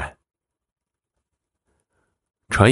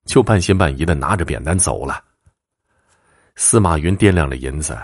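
plain reading voice

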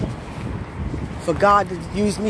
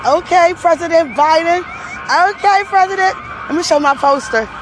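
excited; 9 semitones higher than usual. Real, not acted. Excitedly greeting passing motorcade.

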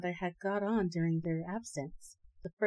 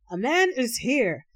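loud monster cry, higher; 5 semitones higher, 7 dB louder than usual. Acted. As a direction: shout it out as the monsters search. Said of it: "crying out all the while".

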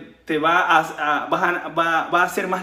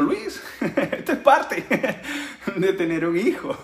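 breaking into real laughter mid-quote, slowed; can't get the words out for laughing; laughing through quote; 2 semitones higher, slower than usual. Real, not acted. Son quoting his father, laughing through the words.

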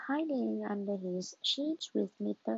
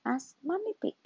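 ordinary delivery

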